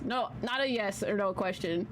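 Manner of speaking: normal talking voice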